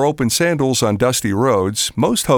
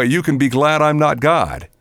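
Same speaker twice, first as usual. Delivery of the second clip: ordinary delivery, nothing marked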